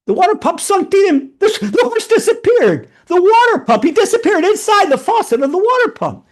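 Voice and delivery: high, loud, amazed narration; astonished storytelling. A narrator's reading. Horse suddenly vanishes into pump.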